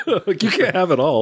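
talking through laughter; chuckling out the words; laughing. Real, not acted.

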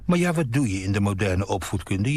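ordinary delivery

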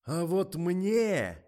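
high, drawn-out bellow; loud, slow, furious build-up; angry roar. A narrator's reading. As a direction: roar it, stretching every word. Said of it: "заревел Николай".

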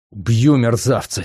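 usual speaking voice

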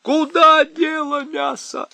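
loud high shout. A narrator's reading. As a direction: yell at the top of your lungs. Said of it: "он крикнул изо всей мочи".

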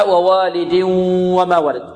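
ووالد flows into وما on a held nasal hum; idgham with ghunnah. Shown as a demonstration. Teacher shows the correct recitation, with ghunnah. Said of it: "الإدغام بغنة في الواو".

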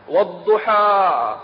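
Quranic recitation. Shown as a demonstration. Preacher reciting ad-Duha to the gathering, slow sung chant. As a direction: chant each verse slowly and fully.

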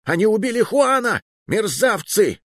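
high-pitched, distraught shouting; terrified cry. A narrator's reading. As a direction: cry out in horror at the killers. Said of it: "перепуганный голос Ди Кастильо". (перепуганно кричать)

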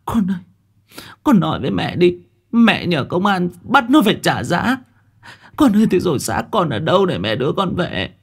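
high, wailing grief-stricken cries; sobbing mother. Acted. Mother sobbing to her dead daughter's spirit, wailing. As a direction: wail for your lost child. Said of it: "bà khuỵu xuống ôm mặt nức nở".

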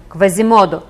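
loud call of the name; shout. A narrator's reading. Claude calls to the dark attacker, loud shout. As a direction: cry out at the attacker. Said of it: "і крикнув".

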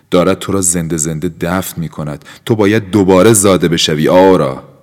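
building to a loud cry; loud urging. Acted. Felipe crying out to Aura to be reborn. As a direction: rise to a shout on آورا.

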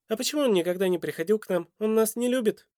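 put-on high, quick child's questioning. A narrator's reading. Boy asks why his father never came, high and childlike.

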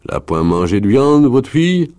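loud question climbing very high; calling to everyone. A narrator's reading. Wolf leader asks the whole household, calling out. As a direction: throw the question out to the whole room, rising steeply. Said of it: "demande le meneur à la cantonade".

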